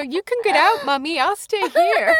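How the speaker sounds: high-pitched mock kid voice; imitating her child. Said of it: "And you go".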